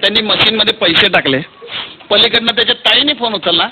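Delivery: ordinary talk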